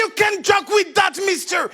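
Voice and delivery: angry guard. Retold or imitated. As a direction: snap it at him as a stern guard. Guard yells at him.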